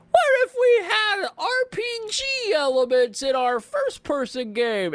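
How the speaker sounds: high, loud excited pitch. Retold or imitated. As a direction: pitch the idea like a hyped dev. Deus Ex pitch, acted quote.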